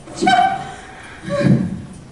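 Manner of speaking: high, airless relieved giggle. Acted. Sister relieved at finding Jane, a high breathy giggle. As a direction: let out a relieved breathy laugh. Lizzie finds Jane hiding.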